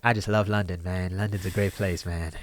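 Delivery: casual American accent, retold lightly. Mimicking Americans raving about London in an American accent. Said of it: "that's my American accent".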